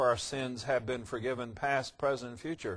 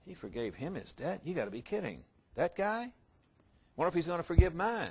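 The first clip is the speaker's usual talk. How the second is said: hushed gossip; 2 semitones higher, 6 dB quieter than usual. Retold or imitated. Other servants gossiping in disbelief.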